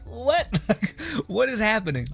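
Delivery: high-pitched, mock-bewildered outburst; baffled disbelief. Viewer seeing skin-tight outfits in anime, baffled disbelief. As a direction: laugh between high, incredulous questions.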